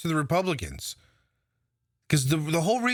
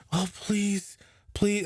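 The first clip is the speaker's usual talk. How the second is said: mock pleading; 5 semitones higher, much slower than usual. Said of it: "to beg and plead".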